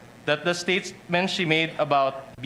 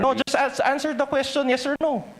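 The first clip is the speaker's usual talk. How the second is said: cutting off the witness; 7 semitones higher than usual. Real, not acted. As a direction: demand a straight yes or no, high and pressing. Lawyer cuts off evasive witness.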